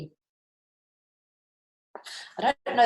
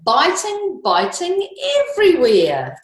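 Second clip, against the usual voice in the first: biting everywhere, up high and louder; high sing-song; 11 semitones higher, 6 dB louder than usual. A narrator's reading. Reader chants the biting line to children, high and sing-song.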